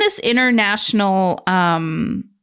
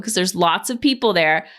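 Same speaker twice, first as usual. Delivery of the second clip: ordinary talk, nothing marked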